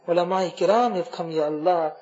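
ordinary talk, nothing marked

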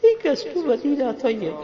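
high, crying supplication; tearful plea. Real, not acted. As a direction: plead it high, close to tears. Repeats plea, overcome with emotion.

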